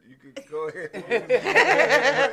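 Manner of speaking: talking through laughter; laughing. Real, not acted. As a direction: laugh while explaining. Laughing at own story.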